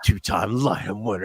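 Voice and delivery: quietly menacing promo, mostly breath at the start; intense promo voice. Retold or imitated. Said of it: "he's intense".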